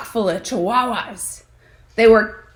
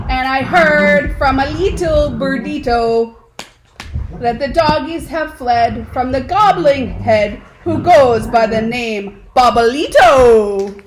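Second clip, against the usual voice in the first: mock Spanish accent; 3 semitones higher, 5 dB louder than usual. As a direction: croon it like a caped hero in a Spanish accent. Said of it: "using his very best Spanish accent".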